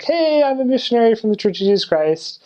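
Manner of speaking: high-pitched eager missionary greeting. Shown as a demonstration. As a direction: introduce yourself as a chirpy, high-voiced missionary. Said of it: "Basically, I just said, like".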